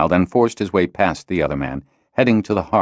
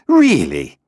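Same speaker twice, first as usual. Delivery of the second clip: louder, emphatic retort; loud and sharp; 7 semitones higher, 5 dB louder than usual. A narrator's reading. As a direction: throw it back firmly. Cedar answers, louder.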